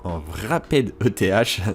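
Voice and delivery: English-accented E T H, said awkwardly; bad English accent. Real, not acted. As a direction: stumble through E T H in a poor English accent. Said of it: "toujours avec mon accent pourri". (avec un mauvais accent anglais)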